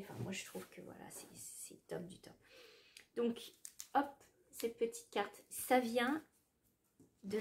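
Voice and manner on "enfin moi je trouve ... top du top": whispering. Real, not acted. Gushing about lovely packaging.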